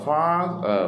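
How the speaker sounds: "father" pulled apart, first syllable high and long; stressed first syllable. Shown as a demonstration. Teacher splitting "father" for learners, first syllable stressed and stretched. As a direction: lean long on "fa", then drop to a light ending. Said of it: "father ആണ് stress ചെയ്യേണ്ടത്".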